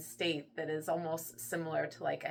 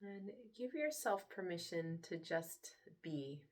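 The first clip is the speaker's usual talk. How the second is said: soft, unhurried meditation voice with long pauses; guided meditation; 2 semitones lower, 7 dB quieter than usual. Acted. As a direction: hush down and gently lead them into relaxation. Leads a guided relaxation.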